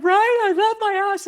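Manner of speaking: very high, loud overwhelmed cry; tearful exclamation. Retold or imitated. Speaker and assistant both crying over her story, loudly overwhelmed. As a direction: blurt it out high, as if overcome. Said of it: "I was crying, and she was crying".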